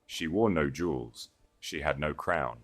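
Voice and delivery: ordinary delivery, nothing marked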